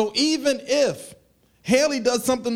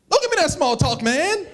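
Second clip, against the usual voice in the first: louder, higher, annoyed snap; an irritated, louder inner retort; mock irritated retort; 7 semitones higher, 5 dB louder than usual. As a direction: bark it back, fed up. Inner angry reply to greeter.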